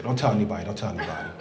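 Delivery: whispering a secret to the crowd, soft and low; secretive hush. Acted. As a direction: keep the secret, hushed. Secretive aside.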